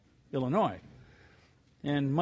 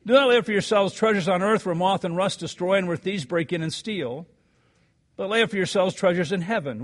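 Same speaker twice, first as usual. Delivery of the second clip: louder, measured Bible reading; scripture reading; 4 semitones higher, 5 dB louder than usual. Acted. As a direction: proclaim the verses to the congregation. Reads scripture aloud to congregation.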